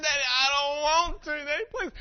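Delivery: loud, high mock-crying child; wailing daughter. Daughter refusing dad's medicine, loud tearful whine. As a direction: wail the plea like a child.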